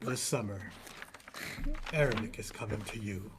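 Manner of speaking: deep, soft, dramatic trailer narrator; low and hushed, like announcing a blockbuster; movie trailer voice. Pretend. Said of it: "like, the movie trailer voice".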